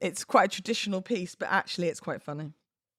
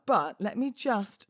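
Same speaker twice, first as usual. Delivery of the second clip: ordinary talk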